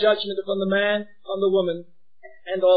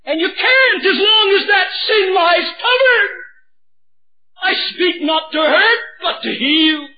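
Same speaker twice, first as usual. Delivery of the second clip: higher and louder, crying out the warning; impassioned preaching; 9 semitones higher, 5 dB louder than usual. Acted. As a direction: preach it at full force. Preaching at full force.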